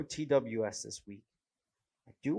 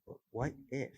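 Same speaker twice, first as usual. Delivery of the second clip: normal talking voice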